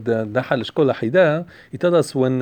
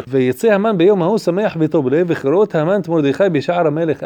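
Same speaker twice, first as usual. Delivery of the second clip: fast, louder chanted reading of the verse; verse recitation; 4 dB louder, much faster than usual. Acted. Rabbi reading the Megillah verse to the class, quick chant. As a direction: rattle the verse off in study chant.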